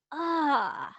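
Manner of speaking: acted sigh of annoyance; frustrated groan. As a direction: let out a mock frustrated "ugh". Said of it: "you're upset and you're frustrated".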